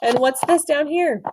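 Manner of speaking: high surprised exclamation. Real, not acted. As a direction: react with amazement. Surprised at the picture.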